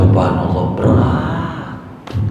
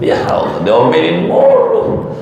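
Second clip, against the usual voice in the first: mock whining complaint; 6 semitones higher than usual. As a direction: whine it like a henpecked man. Complaining about nagging wife.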